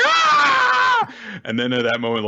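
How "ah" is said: very high, loud shriek; hammed-up cartoon scream of horror; child's mock scream. Child watching the cartoon death scene, mock scream.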